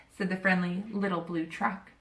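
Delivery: plain reading voice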